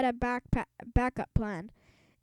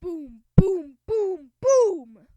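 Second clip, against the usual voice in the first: thudding footsteps; 6 semitones higher, 8 dB louder, much slower than usual. Acted. Principal's footsteps approaching.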